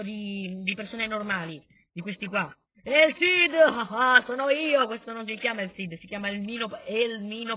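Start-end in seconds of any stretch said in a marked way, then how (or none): 2.8-4.9 loud, high triumphant hero announcement; heroic boast. Pretend. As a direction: proclaim yourself the great hero.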